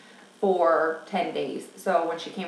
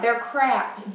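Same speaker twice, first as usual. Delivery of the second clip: normal talking voice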